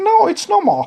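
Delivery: high, breezy "it's no big deal" voice; mock casual shrug. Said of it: "I'll be like".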